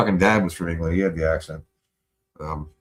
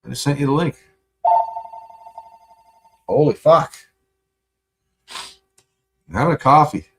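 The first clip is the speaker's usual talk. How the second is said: louder and higher, venting at the caller; exasperated rant; 7 semitones higher, 9 dB louder than usual. Real, not acted. Ranting about a caller who interrupts his coffee, loud and exasperated. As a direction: throw the words out, fed up and loud.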